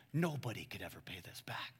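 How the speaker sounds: hushed whisper. Acted. Preacher whispering Jesus's point to the congregation, hushed. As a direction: lean in and whisper it. Teacher's point voiced aside.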